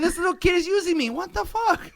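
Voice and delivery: high, fast, laughing disbelief; mock outrage. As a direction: feign being conned by a kid. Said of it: "I'm like".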